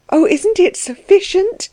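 high, tearful protest; acted weeping exclamation; crying bitterly. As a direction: cry it out high and upset. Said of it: "She cried bitterly".

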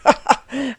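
scornful burst of laughter; mocking laugh. A narrator's reading. Delvig laughing at the lovesick candidate, mocking laugh. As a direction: laugh derisively at him. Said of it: "Delvig laughed loud and long".

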